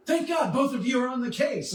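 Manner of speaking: high, laughing mock relief; relieved commissioner. Imitating the commissioner thanking both heroes, high and laughing. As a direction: overplay the grateful commissioner. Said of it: "And he's like".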